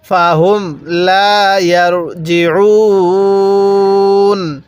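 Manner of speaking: slow recitation, "ইয়ারজিউন" held long before the final n; elongated recitation. Shown as a demonstration. Teacher answering how to read it, slow drawn-out recitation. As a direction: stretch লা and the end of ইয়ারজিউন. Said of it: "তাহলে কিভাবে পড়তে হবে".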